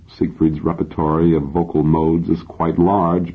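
ordinary delivery, nothing marked

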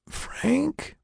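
high, soft, barely managed question; weak whisper. Acted. Waking patient recognizes a voice, weak high whisper. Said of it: "she managed to whisper".

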